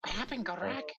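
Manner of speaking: high, panicked call into the dark; alarmed call. Acted. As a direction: call out blind and scared. Blinded, calling out to a friend.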